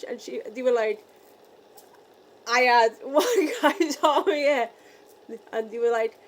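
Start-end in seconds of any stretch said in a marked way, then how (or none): 2.5-4.7 laughing. Real, not acted. Excited retelling, laughing.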